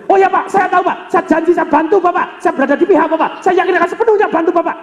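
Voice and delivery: high, fast eager reassurance; mock eager teller promising help. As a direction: play an overeager bank teller. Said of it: "teller saya sudah jagoan".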